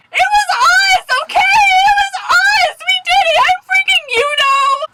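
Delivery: very high, loud, drawn-out confession; imitated scream. Retelling friend screaming the prank confession, mock scream. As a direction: scream it out like a hammed-up outburst. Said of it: "Ezra decided to scream".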